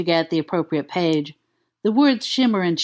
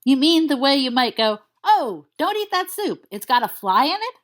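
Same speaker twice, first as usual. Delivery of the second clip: higher, half-panicked questions; a touch hysterical; 6 semitones higher than usual. Acted. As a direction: press him, a little hysterical. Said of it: "only a little hysterically".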